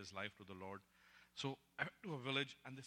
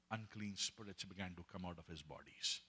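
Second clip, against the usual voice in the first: ordinary talk, nothing marked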